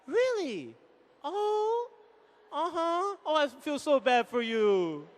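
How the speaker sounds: soft, drawn-out show of sympathy; mock sympathy. Shown as a demonstration. Husband demonstrates listening to stressed wife, hammed-up sympathy. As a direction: coo along softly as if listening, slowing at the end. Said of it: "talk to them. Like".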